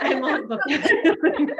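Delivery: high, slow, amused repeat of befuddled; laughing at herself. Real, not acted. Host repeating her own odd word with a laugh. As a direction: savour befuddled through a laugh. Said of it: "That's a new word, right?".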